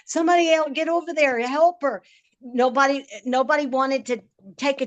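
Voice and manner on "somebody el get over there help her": higher, mock frantic yell from the bank; bystanders screaming. Crowd on the riverbank shouting for someone to help her, higher and urgent. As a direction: call it like a panicked onlooker, but only half-voiced. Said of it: "I can hear them screaming".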